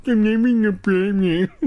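high, mushy-mouthed mimicry; dopey copycat voice with mangled words; garbled mocking. Shown as a demonstration. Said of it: "You gotta do it, like".

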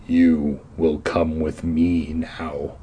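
deep, slow menacing growl; low slow villain threat. A narrator's reading. Caped man growls at the kids, deep and slow. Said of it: "he growled".

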